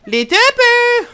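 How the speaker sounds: high, loud, stretched shout; mock war cry; drawn-out battle cry. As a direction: holler it slowly like a hunting call. Hunting call in game.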